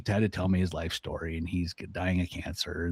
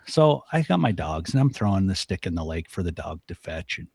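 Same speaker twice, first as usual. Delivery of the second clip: ordinary delivery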